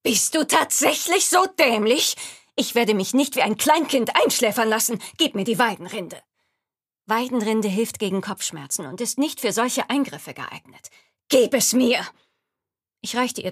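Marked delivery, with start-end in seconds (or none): 0.0-6.2 loud, high, contemptuous scolding; scornful loud contempt. Acted. Laboring woman scorns the healer. 11.3-12.1 loud, high order; loud demand. Acted. Laboring woman demands the bark.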